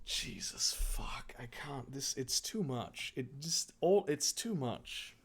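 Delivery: real quiet exasperation, starting in a whisper; soft, overwhelmed aside; overwhelmed muttering. Real, not acted. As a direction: mutter it under your breath, defeated. Reader overwhelmed by the story.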